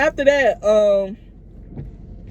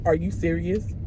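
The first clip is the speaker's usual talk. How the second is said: low, soft deadpan question; understated, unimpressed disbelief; 5 semitones lower, 6 dB quieter than usual. Retold or imitated. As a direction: imitate him asking with a dead face. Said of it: "with a dead face, he said that".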